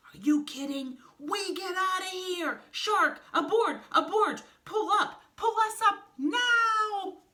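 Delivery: loud, high-pitched panicked yelling; panicked Snake, urgent. Acted. Snake yells at Shark to abort the drop, panicked. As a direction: yell the orders high, stretching the last one.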